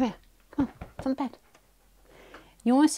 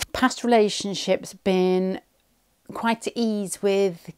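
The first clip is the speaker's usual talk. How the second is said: usual speaking voice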